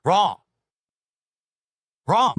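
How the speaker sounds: loud, blunt verdict; emphatic wrong. Real, not acted. Bluntly rejecting a viewer's lore claim, loud. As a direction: bark it like a buzzer.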